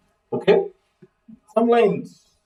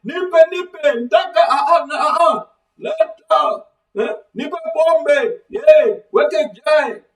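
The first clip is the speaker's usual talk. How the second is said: high-pitched rowdy calls to the brew seller; teacher hams up a passenger shouting from a bus window; mimicking a shouting passenger; 9 semitones higher than usual. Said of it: "this guy shouting from the bus window".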